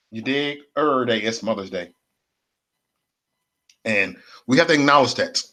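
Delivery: ordinary talk, nothing marked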